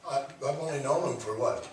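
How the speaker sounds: usual speaking voice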